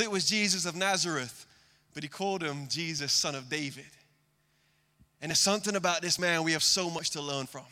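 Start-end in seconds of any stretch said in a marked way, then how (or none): none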